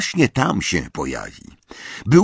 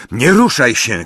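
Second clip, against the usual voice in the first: shout; 4 semitones higher, 6 dB louder than usual. A narrator's reading. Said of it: "krzyknął".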